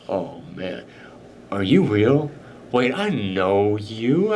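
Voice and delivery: low, slow stoned drawl; tripping out. Acted. Stunt double on acid faces intruders, low dazed voice. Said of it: "he's kinda tripping out a little bit".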